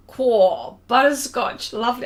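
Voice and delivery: loud British "cor" of relish; imitating British delight at a treat; British-style exclamation. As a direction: relish it loudly like a Brit. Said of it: "I said it again".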